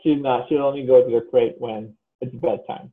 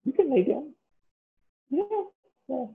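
very high sing-song voice for a dog; puppy voice; 14 semitones higher, 4 dB quieter than usual. Real, not acted. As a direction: coo up high like talking to a puppy. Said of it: "This is [name]'s puppy voice".

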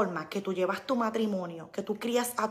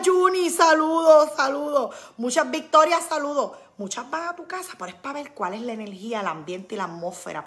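normal talking voice

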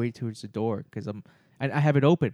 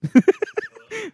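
big high-pitched laughing fit; helpless loud cackling laugh; high burst of laughter; 7 dB louder than usual. Real, not acted. Hosts cracking up at own bit.

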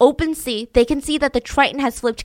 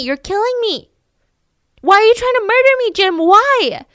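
much higher and louder, fast and panicked; terrified screaming; 11 semitones higher, 7 dB louder than usual. Retold or imitated. Delirious wife accusing her husband of murder, terrified scream.

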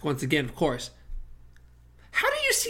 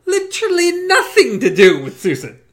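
laughing through the words, higher; 6 semitones higher than usual. Real, not acted. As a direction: laugh while protesting. Laughing at the book's logic.

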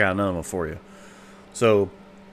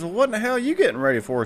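papa voiced much higher and fast; old papa voice; 12 semitones higher, faster than usual. Papa asking mama why she is dressing, much higher and fast. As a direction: rattle it off high and quick.

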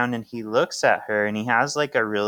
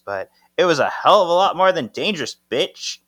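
retold angry retort; 7 semitones higher, 5 dB louder, faster than usual. Acted retort in a quarrel.

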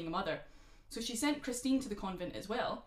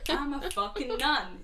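loud proud declaration; mock proud nun; 6 semitones higher, 7 dB louder, slower than usual. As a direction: announce it proudly, a bit slow. Voicing the nun daughter.

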